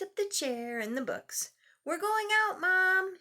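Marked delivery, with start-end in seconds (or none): 1.8-3.2 high, louder call to mom. Acted. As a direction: call out across the house. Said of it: "he called".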